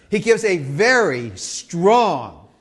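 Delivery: VERY stretched out, loud and slow; emphatic VERY. Shown as a demonstration. Preacher underlining a word for the congregation, emphatic VERY. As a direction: lean hard and long on "very". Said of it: "let me just emphasize this word".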